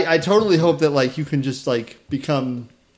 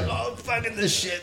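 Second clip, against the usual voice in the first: mock Japanese guy; 8 semitones higher, 10 dB quieter than usual. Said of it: "The Japanese guy who's like".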